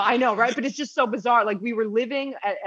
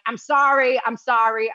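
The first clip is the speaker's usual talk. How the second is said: louder, mock-flustered repeated apology; raised, hurried-to-explain retold sorry; 4 semitones higher, 5 dB louder than usual. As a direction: act out apologizing under pressure.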